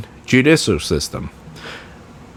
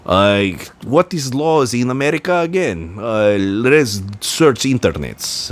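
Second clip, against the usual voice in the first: put-on broken-English Russian accent, dragged out at the end; hammed-up Russian official fumbling for American law; mock Russian officials; 2 semitones higher than usual. Russian officials wondering about American law, mock Russian accent. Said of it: "so they're not gonna be like".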